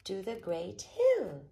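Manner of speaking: loud rallying call; cried out. A narrator's reading. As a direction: lead everyone off with a shout. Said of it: "he cried".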